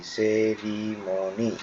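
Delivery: stretched, split-up সেরিমনি; syllable by syllable. Shown as a demonstration. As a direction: sound out সেরিমনি softly as se-ri-mo-ni. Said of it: "দেখো কিভাবে করছে".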